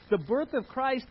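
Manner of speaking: normal talking voice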